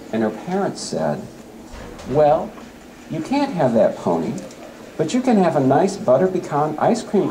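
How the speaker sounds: lively storytelling read-aloud; story poem reading. Acted. Teacher reading a poem about a girl and pony, storytelling. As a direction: tell it like a story for children.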